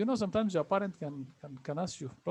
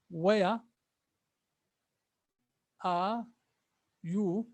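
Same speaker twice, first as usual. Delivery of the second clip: each word set apart with long pauses; slow word by word; much slower than usual. Shown as a demonstration. Teacher to class, slowing down word by word. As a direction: spell it out slowly, one word at a time.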